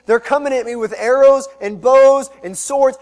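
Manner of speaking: loud rising list, stress on bows; emphatic. Acted. Preacher to congregation on David's foes, loud emphatic list. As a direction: declare it like a charge of weapons.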